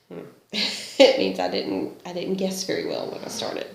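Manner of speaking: ordinary talk, nothing marked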